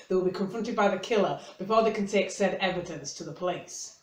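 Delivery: ordinary talk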